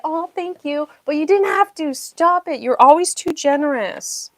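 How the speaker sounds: high, loud, gushing stop-it; touched protest. Real, not acted. Streamer protesting a viewer's generosity, loud high gushing. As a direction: scold them fondly for spoiling you.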